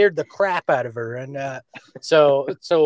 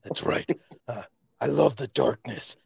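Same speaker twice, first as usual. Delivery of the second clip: whispery vigilante voice; hushed, whispered Batman impression; 4 dB quieter than usual. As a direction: switch into a breath-only Batman. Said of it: "so you combine Batman".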